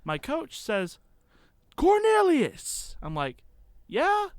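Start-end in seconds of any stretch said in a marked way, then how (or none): 1.8-3.0 high, drawn-out shout of a surname; imitated coach's yell, loud and stretched; coach calling out. Coach calls him across field. 3.9-4.3 high, loud questioning call; re-enacted holler back. Answers coach's call from afar.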